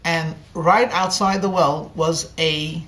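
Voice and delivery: usual speaking voice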